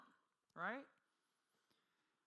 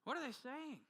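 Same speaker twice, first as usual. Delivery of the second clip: usual speaking voice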